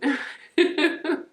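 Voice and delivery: loud giggling laugh. Real, not acted. Amused by the bacon flavour, loud giggle.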